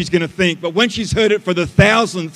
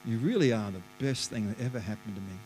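very soft, low and quick; hushed, near whisper; 7 semitones lower, 12 dB quieter, faster than usual. Shown as a demonstration. Coaching husbands to try praising their wives, hushed and low. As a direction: murmur it low, as if trying the words out. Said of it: "Whisper it".

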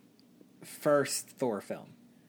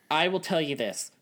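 usual speaking voice

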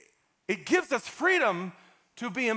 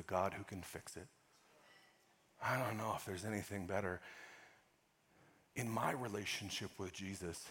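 softer; 10 semitones lower, 13 dB quieter than usual. Real, not acted. Preacher drops to intimate tone.

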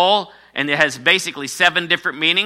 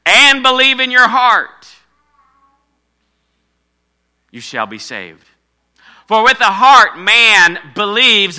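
raised, louder preacher's voice; emphatic preaching; 6 semitones higher, 7 dB louder than usual. Real, not acted. Pastor to congregation on ruling the flesh, emphatic preaching. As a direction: preach it out with punched key words. Preaches scripture at full force.

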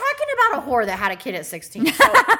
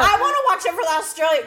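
quick, excited and higher, laughing through it; mock excited; 6 semitones higher, 4 dB louder, faster than usual. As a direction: gush like a new fan. Mock excited self quote.

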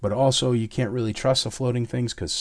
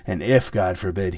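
ordinary talk

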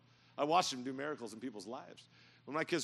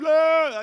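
very loud, high mock wail; hammed-up crying kid; kids' wail; 13 semitones higher, 18 dB louder than usual. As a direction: wail like a frightened child. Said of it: "they're crying".

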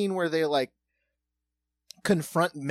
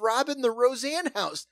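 ordinary talk, nothing marked

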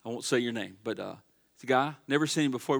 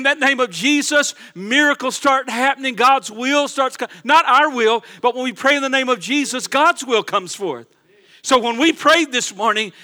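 loud, fervent preaching to the church; full-force preaching; 7 semitones higher, 8 dB louder than usual. Acted. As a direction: drive it home like a revival preacher.

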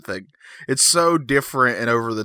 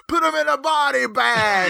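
very high, drawn-out imitation scream; screaming kid; 13 semitones higher, slower than usual. Imitating a kid at a karate tournament, high scream. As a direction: shriek it like a kid in the crowd. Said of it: "one kid in the background just scream".

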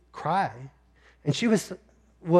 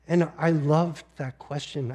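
normal talking voice

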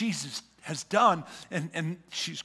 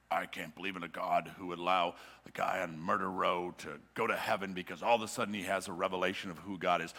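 lower, fast, sneering church critic; mock self-righteous voice; 9 semitones lower, 8 dB quieter, faster than usual. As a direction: play the smug objector. Mocking religious objector.

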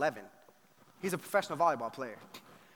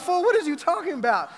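louder, incredulous street-style comeback; mock baffled retort; 11 semitones higher, 11 dB louder than usual. Player scoffs at the pastor's request, loud and incredulous. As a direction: throw it back like he's lost. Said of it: "he looked at me like".

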